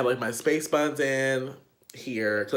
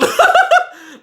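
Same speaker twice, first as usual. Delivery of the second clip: sudden shrieking laugh, much higher and louder; high burst of laughter; 14 dB louder than usual. Real, not acted. Telling friends how she asked him down, high shriek of laughter. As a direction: let a high laugh burst out mid-story. Asking man to get down.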